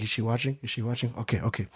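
soft, low, sneaky checking; hushed conspiratorial. Retold or imitated. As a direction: whisper so the nun won't hear. Mom whispering conspiratorially.

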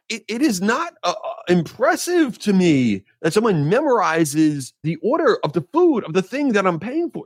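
high-pitched huffy self-defense; exasperated rant. Retold or imitated. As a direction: pitch it way up, protesting he's been wronged. Defending himself to dinner friends.

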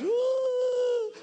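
high, long comic "ooh"; drawn-out silly hoot of alarm; Muppet-style panic wail. Retold or imitated. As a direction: flail vocally like a panicking puppet. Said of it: "just panic like Muppets just running around".

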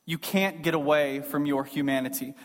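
usual speaking voice

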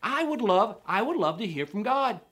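high, put-on casual voice; keen casual listener. Church listener wishing to hear from God, mock casual keenness. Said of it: "hear, say".

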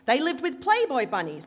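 put-on setting up "Playboy bunnies" in a Brooklyn accent; strident Brooklyn accent. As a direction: Brooklyn mama telling the scandal, "Playboy" punched. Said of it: "in her strident Brooklyn accent".

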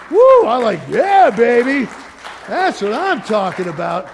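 real burst of pulpit excitement; exuberant whoop. Real, not acted. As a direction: whoop it out, then stretch the cheer. Exulting after the list.

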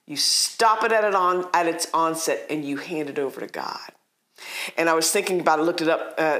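normal talking voice

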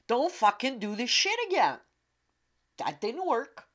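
loud, annoyed self-reprimand; scolding note to self. Retold or imitated. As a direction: snap at yourself.

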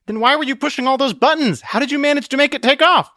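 high, urgent questioning; alarmed demand. Acted.

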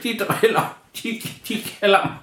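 high, mildly whiny pretend tears; the creature, teased, pretends to cry in a high voice; mock crying. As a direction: whimper it like a sulking little goblin, lightly. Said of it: "han begyndte lidt at græde".

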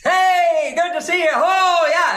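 high, loud, over-the-top greeting; exaggerated fire voice. Shown as a demonstration. Teacher mimicking that overbearing greeter, exaggerated fiery shout. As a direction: holler a hammed-up hello. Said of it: "The exaggerated version is".

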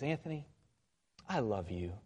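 soft and quiet, leaning in close; a quiet, intimate retold confession on a date; tender. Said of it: "she just leans in and she goes".

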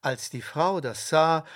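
usual speaking voice

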